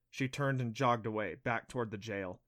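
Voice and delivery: ordinary delivery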